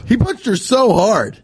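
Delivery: high-pitched amazed exclamation; incredulous. Real, not acted. Cohost recounts the TV punch, incredulous.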